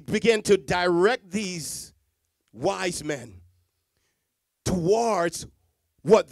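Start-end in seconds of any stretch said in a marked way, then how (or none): none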